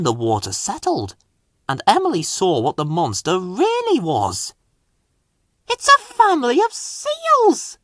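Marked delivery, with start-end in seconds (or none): 5.7-7.8 very high, thrilled exclamation; high, bright surprised joy; delighted cry. Acted. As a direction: burst out in delight. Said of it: "she cried delightedly".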